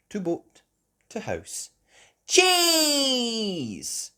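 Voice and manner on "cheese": higher, loud stretched-out piglet; Peppa voice. A narrator's reading. Peppa handing over shopping, higher loud voice. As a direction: announce it like a proud little girl. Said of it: "Peppa said".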